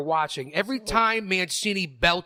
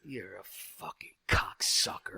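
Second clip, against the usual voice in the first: hushed, voiceless jab; whispered insult; 12 semitones lower, 10 dB quieter than usual. Real, not acted. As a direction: breathe the insult under the breath.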